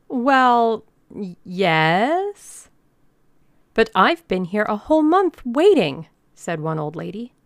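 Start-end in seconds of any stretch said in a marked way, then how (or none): 0.1-2.7 loud, stretched, stammered yes; hesitant admission. A narrator's reading. Organizer asked whether there is a rocket, hesitant. 3.7-6.1 high, loud protest; complaining old lady. A narrator's reading. Old lady protests a month of waiting, loud and aggrieved.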